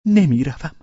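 ordinary delivery, nothing marked

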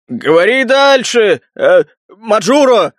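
slow, drawn-out heckler's shout; bass shout. A narrator's reading. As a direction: call out heavily from the crowd. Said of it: "крикнули басом". (протяжный выкрик басом)

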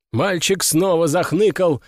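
ordinary delivery

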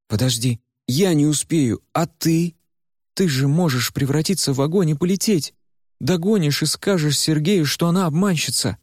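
put-on high, desperate girl's voice; Варя in despair. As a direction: ask in rising panic. Varya pleads with Куйгарош. (высоким, отчаянным голосом Вари)

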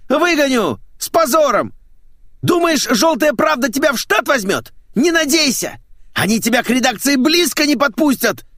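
high, loud raging tirade; hysterical screaming. Acted. Boss threatening to sack the traitor, hysterical screaming. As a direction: keep screaming insults without letting up. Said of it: "истерические вопли начальника".

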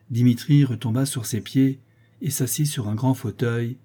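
plain reading voice

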